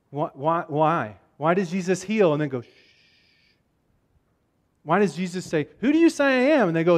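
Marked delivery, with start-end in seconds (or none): 2.6-2.7 long, drawn-out "shh"; hushing shh. Acted. Jesus hushing people after healing them, hushing. 5.8-6.7 higher-pitched acted question; probing question. Jesus asking the disciples who he is, probing.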